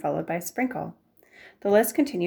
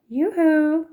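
high, loud sing-song yoo-hoo; calling out; 10 semitones higher, 7 dB louder than usual. Acted. Neighbor announcing herself at the back door, high loud call.

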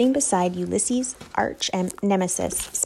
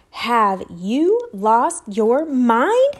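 very slow, louder, incredulous; exasperated; 3 semitones higher, 5 dB louder, much slower than usual. Acted. Mother scolds the father, slow and exasperated. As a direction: drag out each word in disbelief.

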